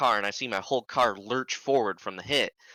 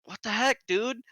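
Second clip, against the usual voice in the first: high-pitched, incredulous question; retold exasperated challenge; indignant protest; 12 semitones higher than usual.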